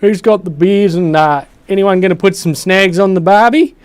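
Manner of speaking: loud, high, hammed-up Australian accent; full Aussie. To his viewers, hammed-up loud Australian accent. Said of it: "I'm going full Aussie again".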